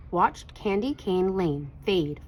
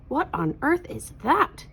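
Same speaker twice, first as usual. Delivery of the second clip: higher, startled outburst; exclaiming; 8 semitones higher than usual. A narrator's reading. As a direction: cry out in amazement at the sight. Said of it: "exclaimed the reindeer".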